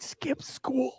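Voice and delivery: hushed aside about skipping school; whisper. Retold or imitated. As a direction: let it slip like a confession, slow.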